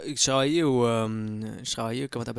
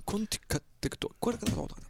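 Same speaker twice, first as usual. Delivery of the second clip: higher, softer quacking gibberish; duck voice; 7 semitones higher, 10 dB quieter than usual. Pretend. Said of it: "J'essaie d'imiter".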